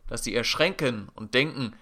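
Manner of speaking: normal talking voice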